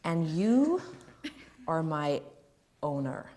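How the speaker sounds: measured, deliberate delivery; lightly voiced film actor. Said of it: "do this impersonation".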